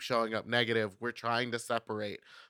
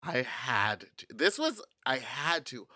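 ordinary delivery

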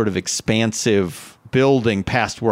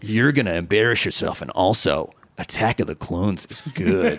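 morning DJ voice; 6 semitones lower, 3 dB quieter than usual. Retold or imitated. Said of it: "a morning D J".

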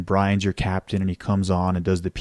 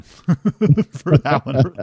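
laughing while talking; 3 semitones higher, 5 dB louder, much slower than usual. Real, not acted. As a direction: laugh through the words. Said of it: "laughs heard".

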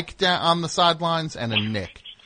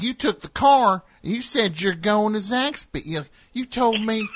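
yelling Southern dad; 9 semitones higher than usual. Retold or imitated. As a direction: imitate a Southern father telling off his daughter. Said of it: "he's yelling at his daughter".

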